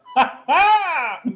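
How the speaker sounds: high, loud imitation of the wizard's cackle; crazy mimic laugh. Automaton copying the wizard's laugh, high and loud laughter. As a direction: ape the wizard's laugh like a machine gone a bit wrong. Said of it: "a crazy approximation of the wizard's voice".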